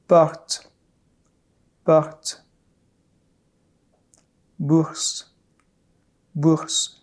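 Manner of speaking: porte and bourse with a soft, barely heard throat r; soft French r. Shown as a demonstration. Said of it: "softer and less pronounced".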